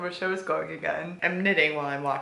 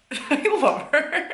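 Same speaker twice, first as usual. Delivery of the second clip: laughing gush; 10 semitones higher, 7 dB louder, slower than usual. Real, not acted. Gushing over a favourite character.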